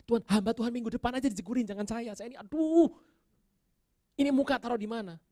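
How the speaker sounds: high, loud comic wail to God; desperate pleading. Retold or imitated. Embarrassed preacher begging God to spare him, desperate. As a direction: beg to be spared, climbing into an exaggerated moan.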